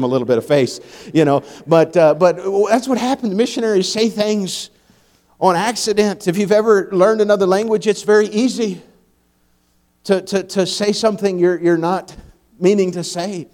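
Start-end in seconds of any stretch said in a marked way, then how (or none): none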